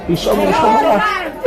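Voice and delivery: ordinary talk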